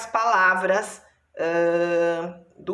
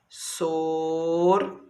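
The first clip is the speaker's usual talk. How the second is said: "Sorvete" as sor, ve, te, with long pauses; lower, stretch each syllable of "Sorvete" far apart; slow syllable separation; 4 semitones lower than usual. Shown as a demonstration. Teacher dictating while separating, long gaps between syllables. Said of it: "separe as sílabas".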